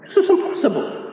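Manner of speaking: high, emphatic "impossible"; "impossible" stressed as a firm verdict. Real, not acted. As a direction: lift the pitch and lean on "impossible". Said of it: "I like to stress that word impossible".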